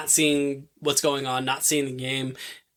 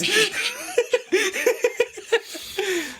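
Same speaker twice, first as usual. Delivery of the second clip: a burst of high, real laughter. Real, not acted. Podcast host cracking up at her own retelling, high laugh.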